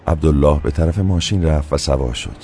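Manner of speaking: ordinary delivery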